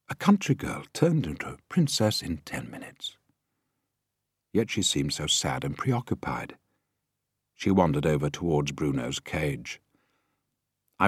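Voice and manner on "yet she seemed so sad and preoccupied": ordinary delivery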